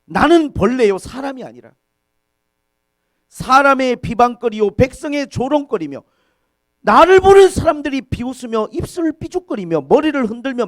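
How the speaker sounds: loud, high-pitched recited complaint; anguished lament. Retold or imitated. David in torment over his mockers, loud lament. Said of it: "얼마나 고통스러우면 다윗이 그렇게 말했어요".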